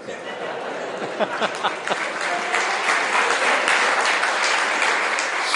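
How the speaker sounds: a long unvoiced laugh; breathy laugh. Real, not acted. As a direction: laugh out at the punchline. Speaker laughs after the tale. (હળવું હાસ્ય)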